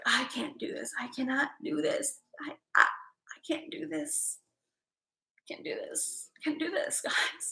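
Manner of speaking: soft, hushed, breathy panic; whispered self-doubt. Retold or imitated. Retelling her doubts to viewers, whispered. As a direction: whisper it like you're talking yourself out of it. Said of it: "I was like".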